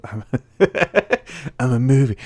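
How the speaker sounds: edgy hero voice breaking into laughs; loud brooding voice, laughing through it; Shadow the Hedgehog voice. Acted. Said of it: "I'm stuck in my Shadow the Hedgehog voice".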